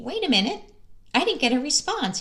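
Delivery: a taken-aback parent thinking aloud; surprised. Pretend. Parent ignored by the introverted child, mock surprise.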